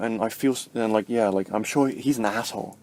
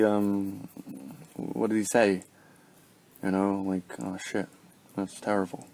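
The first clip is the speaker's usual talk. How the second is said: detached, disengaged; 5 dB quieter, slower than usual. Shown as a demonstration. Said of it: "if you were in low vibration".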